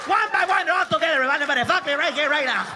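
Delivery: dad shouting. Retold or imitated. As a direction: dare the whole field to fight. Dad shirtless yelling.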